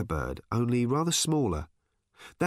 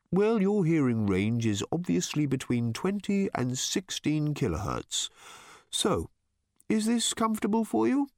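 lightly raised bird voice; pleasant light tenor; 6 semitones higher than usual. A narrator's reading. Bird checking Random's comfort, pleasant light tenor. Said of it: "in a pleasant, light tenor".

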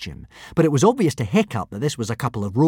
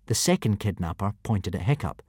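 ordinary delivery, nothing marked

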